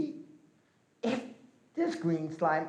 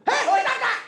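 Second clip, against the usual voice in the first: much higher, louder startled cry from below; startled eagle call; 8 dB louder than usual. Acted. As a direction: shout up from the pit, startled. Trapped eagle calls from crack.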